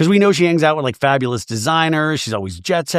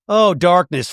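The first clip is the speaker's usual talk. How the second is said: usual speaking voice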